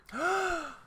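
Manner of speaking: high sharp theatrical gasp; hammed-up shocked gasp; mock gasp. Mock surprise at reveal.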